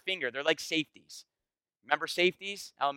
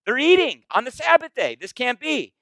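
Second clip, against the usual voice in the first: mock outrage; 8 semitones higher, 7 dB louder than usual. Mocking the outraged Pharisees.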